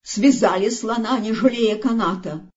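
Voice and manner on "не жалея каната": ordinary delivery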